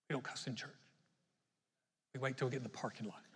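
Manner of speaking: low, soft confiding aside, a bit quick; mock hushed. Churchgoer hushing a confession to the congregation, mock hushed. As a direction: confess it under the breath like a sheepish churchgoer. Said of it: "You're like".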